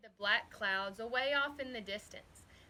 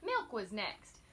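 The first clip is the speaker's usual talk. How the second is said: ordinary delivery, nothing marked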